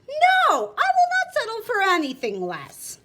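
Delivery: loud, high insistent no; firm refusal. Acted. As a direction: slam the door on any deal. Shopkeeper refusing any compromise.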